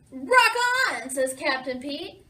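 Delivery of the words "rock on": loud, higher rallying call; enthusiastic cheer. A narrator's reading. Captain Pete to the drumming sea monster, loud excited cheer. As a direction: cheer on a fellow rocker.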